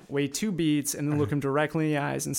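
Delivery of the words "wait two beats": normal talking voice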